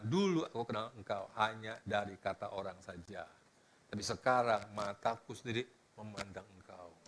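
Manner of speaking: low, soft, humbled confession; pengakuan khidmat. Acted. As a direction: speak low and softly to God. Membacakan ucapan Ayub kepada Tuhan. (pengakuan khidmat dengan suara rendah)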